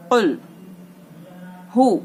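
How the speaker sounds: कुल with heavy क, हु from the low throat; heavy qaf, low ha. Shown as a demonstration. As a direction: darken कुल, breathe हु from the chest. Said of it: "इस हु को".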